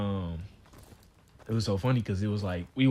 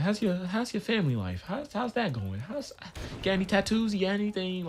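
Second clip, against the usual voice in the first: higher-pitched chatty officer imitation; casual small talk; 9 semitones higher than usual. Front desk officer making it seem cool to be in there, chatty. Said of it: "trying to make it seem like it was cool".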